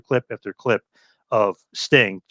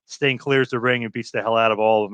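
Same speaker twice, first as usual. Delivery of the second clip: ordinary delivery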